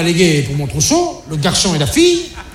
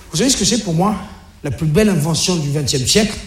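question posée; 4 semitones lower, faster than usual. Acted. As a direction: question posée, voix retenue et plus basse sur la fin. Le père pose sa devinette aux ados. (devinette posée calmement)

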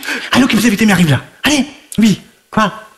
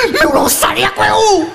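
voix de femme aiguë et indignée; femme en colère; 10 semitones higher than usual. Acted. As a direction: joue l'épouse trompée furieuse. La femme découvre la maîtresse. (l'épouse jalouse, voix aiguë et outrée)

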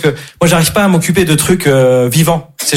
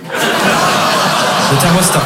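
thermostat stretched out slowly, breathy; slow breathy drawl; much slower than usual. Pretend. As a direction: drag the word out, half whispered. Teacher repeats, drawn out.

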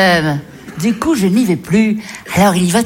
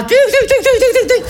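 cat steps. Retold or imitated. Mimics cat's nervous steps.

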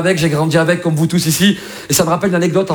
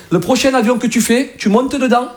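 teacher scolding; 3 semitones higher, slower than usual. Retold or imitated. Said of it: "elle m'a dit".

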